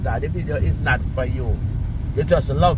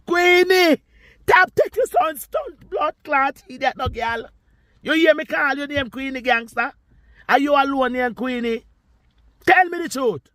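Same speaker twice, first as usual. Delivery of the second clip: much higher, shouting her name then ranting; yelling at Queenie in a high, furious pitch; shrill angry outburst; 12 semitones higher than usual. Real, not acted. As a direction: let it fly up high at Queenie.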